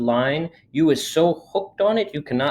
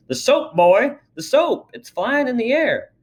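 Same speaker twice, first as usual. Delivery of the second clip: excited father; 10 semitones higher, 3 dB louder than usual. Acted. Father sees floating soap.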